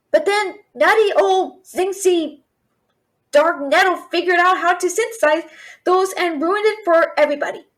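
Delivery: ordinary talk, nothing marked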